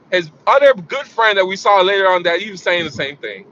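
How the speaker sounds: ordinary talk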